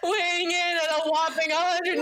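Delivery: mock ring announcer through laughter; wrestling announcer. Teasing mom as a wrestler, hammed-up announcer through giggles. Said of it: "your wrestling name".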